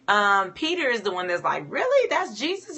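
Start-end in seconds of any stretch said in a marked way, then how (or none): 1.7-2.8 surprised. Retold or imitated. Peter seeing Jesus on water.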